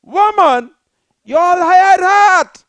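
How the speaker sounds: high, loud, slow shouted scolding. Retold or imitated. Scolding the evicted woman she wanted to curse out, shouting. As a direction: bellow it at her. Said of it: "I wanted to say to her".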